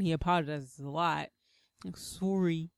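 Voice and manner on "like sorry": Canadian-accent sorry, lightly imitated. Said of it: "with his Canadian accent".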